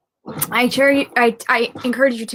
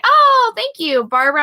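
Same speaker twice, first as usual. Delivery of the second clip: delighted thanks; 10 semitones higher, 5 dB louder than usual. Real, not acted. Thanks a first-time sharer.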